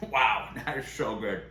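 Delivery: talking with a mouth full of food. Real, not acted. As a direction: say it through a mouthful. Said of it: "Sorry I'm talking with my mouth full".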